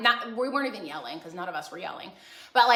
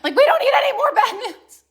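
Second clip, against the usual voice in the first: dismayed reaction; 6 semitones higher, 10 dB louder than usual. Real, not acted. Reacts to friend's flooded basement.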